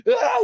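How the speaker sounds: high squeaky yelp; scared yelp. Retold or imitated. Butters firing the gun, high yelp. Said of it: "He's like".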